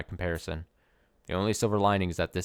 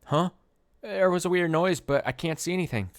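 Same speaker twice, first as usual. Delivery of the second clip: alarmed; 10 semitones higher, 3 dB louder than usual. Acted. Strange noise, unseen threat.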